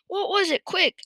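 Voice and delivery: high, eager questioning; urgent demand. A narrator's reading. Farmer desperate for the missing ingredient, urgently asking. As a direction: hurry the answer out of him.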